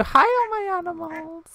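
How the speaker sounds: very high, drawn-out sing-song hello; gushing pet greeting. Pretend. Player greets all their animals, gushing and high. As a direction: coo it high and long.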